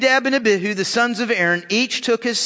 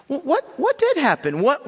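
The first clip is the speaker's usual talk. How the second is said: ordinary talk, nothing marked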